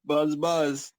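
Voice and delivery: playful buzzing bee voice. Pretend. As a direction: buzz it like a bee. Buzzing like bees in joke.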